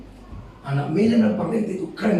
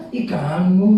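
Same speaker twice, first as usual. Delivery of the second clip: louder, coy "kamu" with rising end; mock cutesy; 6 dB louder, much slower than usual. Shown as a demonstration. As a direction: coo "Ih, kamu" as a cute caricature. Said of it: "Lucu. Lucu namanya".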